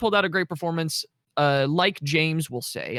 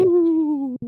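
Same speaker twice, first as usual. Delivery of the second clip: spooky ghost ooh; 13 semitones higher, 5 dB louder than usual. Pretend. Spooky reaction to Halloween intro.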